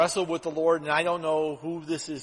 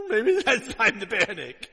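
laughing; 4 dB louder than usual. Real, not acted. Joke about the scared leader.

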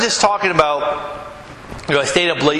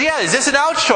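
put-on a little girl's higher question; daughter's voice; 8 semitones higher, faster than usual. Daughter insisting to her dad in the aisle, higher childlike voice.

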